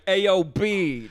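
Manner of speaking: loud, high, drawn-out Brooklyn greeting; fake Brooklyn accent. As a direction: holler in a mock Brooklyn accent. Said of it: "the Rachel Dolezal of Brooklyn".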